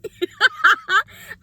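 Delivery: big squealing laugh; loud high laugh. Real, not acted. Laughs at own joke.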